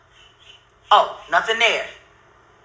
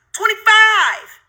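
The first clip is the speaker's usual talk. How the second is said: exasperated shout; 5 semitones higher, slower than usual. Real, not acted. Angry counting of her videos.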